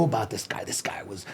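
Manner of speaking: dropped to a whisper; conspiratorial whisper about the rival; hushed. Real, not acted. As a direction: lean in and whisper it. Drops to whisper.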